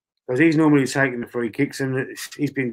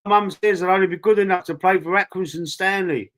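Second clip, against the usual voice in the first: higher-pitched, shaky-attempt Scouse advert impression; attempted Scouse accent; 6 semitones higher than usual. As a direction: imitate a Scouse milk advert, roughly. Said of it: "I can't do the Scouse accent".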